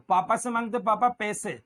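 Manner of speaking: usual speaking voice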